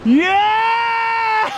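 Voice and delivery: triumphant high yell; excited shout. Real, not acted. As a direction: roar it out in victory. Triumphant yell after landing fish.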